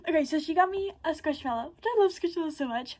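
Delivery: usual speaking voice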